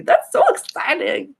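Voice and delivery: very high, loud delight; high gushing excitement; excited squeal. Real, not acted. Excited reaction.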